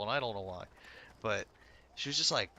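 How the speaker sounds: ordinary talk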